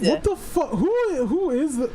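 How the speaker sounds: high, shocked outcry; stunned disbelief. Real, not acted. Gamer reacting to a sudden death in the ring, shocked. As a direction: blurt it in total disbelief.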